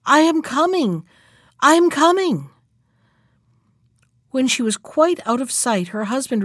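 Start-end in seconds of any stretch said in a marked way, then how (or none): 0.1-2.5 high, raised storybook cry; calling out loudly. A narrator's reading. Wife calling as she runs from husband, loud cry. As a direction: call back to the voice. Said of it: "calling at the top of her voice as she ran".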